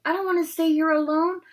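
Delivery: high, loud and scared; frightened Neville. Acted. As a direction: panicky pleading. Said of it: "scrambling to his feet".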